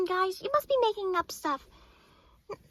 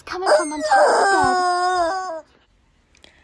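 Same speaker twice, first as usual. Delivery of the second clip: dramatic reveal; 6 dB louder, much slower than usual. Acted.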